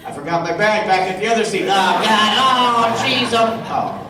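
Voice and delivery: hammed-up flustered fan; flustered con walker. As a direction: panic about the forgotten bag. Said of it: "And then you go".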